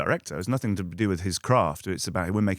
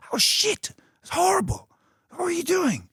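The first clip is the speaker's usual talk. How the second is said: much higher, dismayed mock director; 3 dB louder than usual. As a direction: squeal out the director's horror in a high put-on voice. Mimicking director's private criticism.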